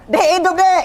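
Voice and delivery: high-pitched retold shout to his wife; re-enacted joyful yell; excited shout. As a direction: cry it out high, half laughing. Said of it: "Saya teriak".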